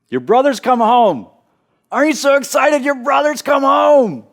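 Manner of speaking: high, loud, thrilled shout of good news; excited announcement. Retold or imitated. Servant announcing brother's return excitedly.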